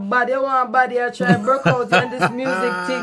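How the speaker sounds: loud, scolding mother voice. Retold or imitated. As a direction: bark it like a strict mom. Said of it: "She said".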